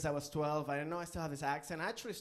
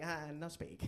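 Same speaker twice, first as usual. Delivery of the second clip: broken English; 7 dB quieter than usual. Retold or imitated. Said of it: "I'm like".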